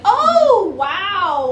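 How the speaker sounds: high, loud, stretched-out oh; amazed reaction. Retold or imitated. Reader recalling reading the twist, exaggerated amazement. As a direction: play up being bowled over.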